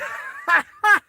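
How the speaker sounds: high, cackling laugh; character's laugh. Acted. Vash's character is a real character, high cackle. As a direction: cackle high in short bursts. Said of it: "he's like".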